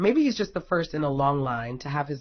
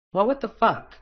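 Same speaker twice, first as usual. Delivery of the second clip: higher, louder, incredulous snap; exasperated outburst; 7 semitones higher, 5 dB louder than usual. Acted. Azura yelling at Indra over his ribs, exasperated shout. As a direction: Azura blowing up at him, what the hell.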